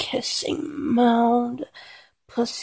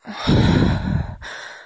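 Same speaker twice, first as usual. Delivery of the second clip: heavy sigh; 5 dB louder than usual. Acted. Sigh between verses.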